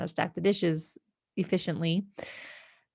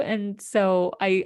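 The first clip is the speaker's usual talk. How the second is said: usual speaking voice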